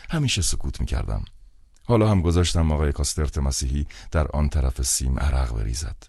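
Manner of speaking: usual speaking voice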